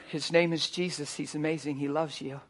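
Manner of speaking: soft, low and tender; gentle reassurance. Retold or imitated. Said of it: "I just told her".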